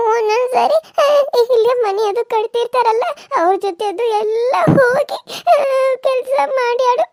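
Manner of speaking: put-on high tearful child's voice, pleading through sobs; a small daughter begging her father, voice breaking with tears; crying little girl. Daughter begs her father not to leave them, crying.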